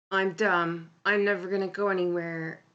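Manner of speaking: ordinary talk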